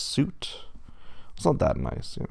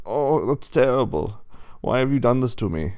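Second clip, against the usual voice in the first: put-on German-accented pig; 4 semitones higher, 6 dB louder than usual. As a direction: lament it in a louder German accent. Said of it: "but he's German".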